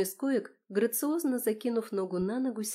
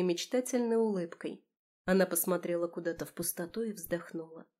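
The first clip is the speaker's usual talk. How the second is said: ordinary delivery, nothing marked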